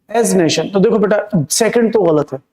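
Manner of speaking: ordinary talk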